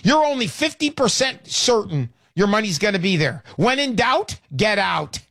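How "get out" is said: normal talking voice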